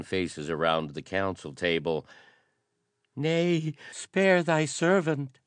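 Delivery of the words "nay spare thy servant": high, slow, frightened begging; pleading courier. A narrator's reading. Terrified courier begging the prince to spare him. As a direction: plead for mercy, voice pitched up in fear. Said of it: "begged the green-kilted courier".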